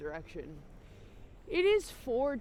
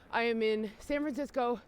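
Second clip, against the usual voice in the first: usual speaking voice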